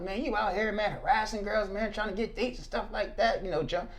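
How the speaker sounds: lightly voiced older stranger, accusing; a hint of a scolding older man; imitating the older man.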